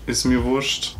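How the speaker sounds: "wurscht" with a soft sh, in Swabian dialect; a Swabian says it casually. Shown as a demonstration. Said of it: "that's basically Schwäbisch".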